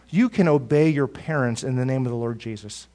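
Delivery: ordinary talk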